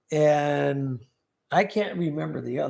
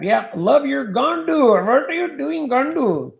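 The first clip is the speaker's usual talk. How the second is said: mock scammer voice; 7 semitones higher, 3 dB louder than usual.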